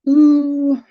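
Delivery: high, loud ooh of surprise; a sudden bright ooh; delighted ooh at the news; surprised ooh. Real, not acted. Reacting to comment.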